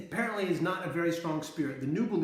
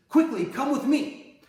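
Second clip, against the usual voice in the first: higher, louder and slow urgent summons; urgent call; 8 semitones higher, 8 dB louder, slower than usual. Retold or imitated. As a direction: hurry her along to safety. Urgent escape call in story.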